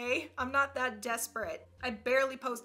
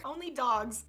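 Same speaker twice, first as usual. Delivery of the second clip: ordinary talk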